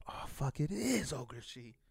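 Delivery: slow, drawn-out mock amazement; retold dawning realization. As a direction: let the recognition stretch out slowly. Retold dawning recognition of the face.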